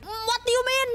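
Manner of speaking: high, indignant mock outrage; put-on anger. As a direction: act as angry as you can, unconvincingly. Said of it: "put on the angriest face I possibly could".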